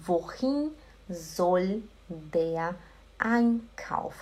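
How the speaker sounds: slow, separated words for echoing; repeat-along model. Shown as a demonstration. Teacher asking students to repeat along, slow and word by word. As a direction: give each word its own beat, softly. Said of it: "Repete junto comigo".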